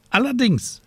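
high, fussy scolding raven voice. A narrator's reading. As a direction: play a worried, scolding raven. Raven replies.

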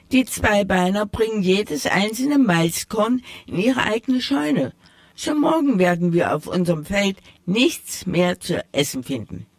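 a gentle hint of a caring mouse mother. Old mouse warns the children.

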